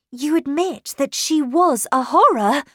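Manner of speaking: high, loud, pressing question; cried out. A narrator's reading. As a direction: cry out, demanding an admission. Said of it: "I cried".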